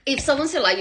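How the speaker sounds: ordinary talk, nothing marked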